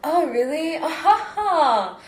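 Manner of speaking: high, mock-polite interest with fake laugh; put-on airy small talk, slow fake chuckle; softened code-switched voice. Shown as a demonstration. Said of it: "add a bit of softness".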